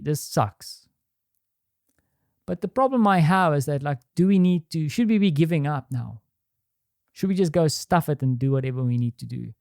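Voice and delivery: ordinary talk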